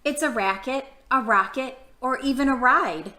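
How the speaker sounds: lilting read-aloud for little listeners; reading to children. Acted. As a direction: read each picture-book line gently to the kids. Reading picture book to kids.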